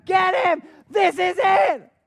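high, very loud urgent shout; full-force cry urging someone on; urgent scream. Acted. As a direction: scream it at him, drawing out the last words. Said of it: "they're screaming at him".

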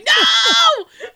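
loud, high comic wail of refusal; overwhelmed shout. Retold or imitated. Imitates overwhelmed cat from posted meme.

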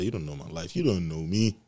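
ordinary delivery

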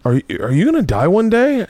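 put-on high, hesitant little-kid question; panicking child. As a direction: imitate a scared kid asking dad. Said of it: "I started panicking".

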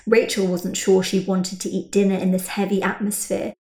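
usual speaking voice

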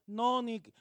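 ordinary talk, nothing marked

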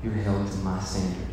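very low, hushed divine verdict; God pronouncing judgment. Acted. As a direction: play God quietly laying down the law, deep in pitch. God answers excuses at judgment.

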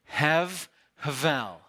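hev havel said slowly, in two breathy puffs; two breaths. Shown as a demonstration. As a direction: hev then havel, blowing air out on each. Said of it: "So let's say it".

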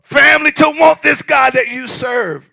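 loud, high, slow outcry; real passion, near shouting; passionate preaching. Real, not acted. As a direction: pour it out on the congregation. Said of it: "am I sounding passionate? I'm not screaming".

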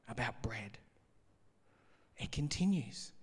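breathy whisper of the punchline; whispered aside. Acted. Preacher lands the bread joke in a whisper. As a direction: drop it to a whisper.

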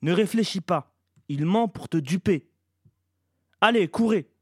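high, loud warning building to a yell; urgent shouting. A narrator's reading. Shea orders her son to run from the liar, shouting urgently. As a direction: drive them on, loudest at the end. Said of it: "lui cria Shea".

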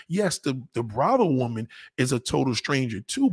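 usual speaking voice

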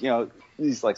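ordinary talk

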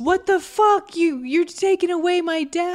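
high-pitched mock outrage; super angry. Retelling Tony seeing his dad taken away, mock-angry. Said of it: "So he's super angry".

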